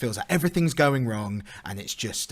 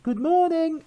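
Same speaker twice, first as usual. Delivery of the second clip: very high, cheery sing-out; masked passer-by greeting; 4 dB louder, slower than usual. Retold or imitated. Stranger greeting someone out in the street, high put-on cheer. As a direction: imitate a stranger calling a greeting through a mask. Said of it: "someone would say, you know, with a mask on".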